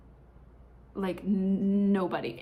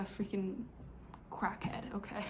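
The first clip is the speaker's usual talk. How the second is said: whispered under the breath; a hushed, cheeky aside; 10 dB quieter than usual. Real, not acted. Vlogger whispers about her misbehaving cat. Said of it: "unvoiced aside about cat".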